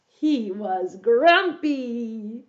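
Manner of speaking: very slow, stretched "grumpy"; drawn-out answer. Pretend. Storyteller gives children the answer, slow and drawn out. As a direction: stretch out "grumpy" for young listeners.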